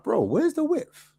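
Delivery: high, slow, disbelieving cry; exasperated. Retold or imitated. Fan watching the team play with no width, exasperated high cry. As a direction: ask it like you can't believe your eyes. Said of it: "I'm like".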